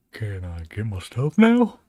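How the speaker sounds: loud, slow and fed up; slow it down and demand it loudly. Real, not acted. Fed-up streamer loudly demanding his files from the computer.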